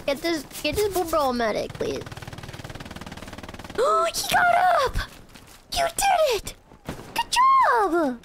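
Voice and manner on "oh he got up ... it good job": high shouted cheer; excited cheering. Real, not acted. Cheering teammate.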